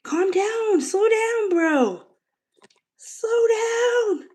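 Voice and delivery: high squealing plea to cat; high laughing pet talk. Real, not acted. Cat gobbling food.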